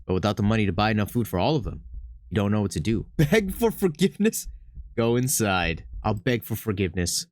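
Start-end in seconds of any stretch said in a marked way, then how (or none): none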